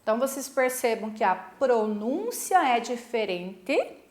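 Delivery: ordinary delivery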